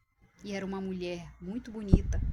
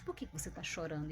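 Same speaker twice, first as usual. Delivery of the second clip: cold, quiet and quick, flat of feeling; coldly; 6 semitones lower, 9 dB quieter, faster than usual. Retold or imitated. Woman in the dream asks the crying dreamer, coldly. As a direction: ask it without a trace of care. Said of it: "Ela falou friamente".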